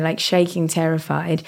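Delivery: ordinary delivery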